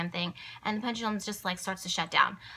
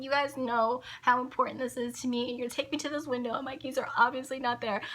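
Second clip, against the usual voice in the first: higher, pleading with the spirits; emotional plea; 4 semitones higher than usual. Retold or imitated. Pleading with the spirits about the missing keys, upset. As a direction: beg the spirits, upset and close to tears. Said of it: "I really was getting emotional".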